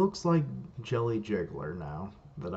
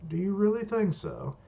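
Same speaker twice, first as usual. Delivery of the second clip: much higher-pitched questioning retort; challenging retort; 10 semitones higher, 4 dB louder than usual. Acted. Answering the threat to make him regret it, high challenge. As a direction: throw it back in a high voice.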